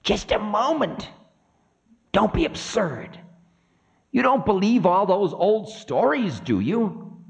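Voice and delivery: high-pitched fussy objection; high, incredulous figure of Time; Time, dismissive. Acted. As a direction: protest in a high voice. Time dismisses Ravana's claim.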